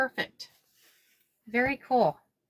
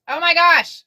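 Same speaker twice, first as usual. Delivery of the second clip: delighted; 6 semitones higher, 7 dB louder than usual. Real, not acted. Delighted at the finished bag.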